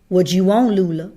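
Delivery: cold challenge. A narrator's reading. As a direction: Calpurnia facing down a hostile woman. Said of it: "in tones I'd never heard her use".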